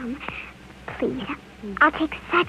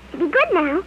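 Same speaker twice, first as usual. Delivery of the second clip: ordinary talk, nothing marked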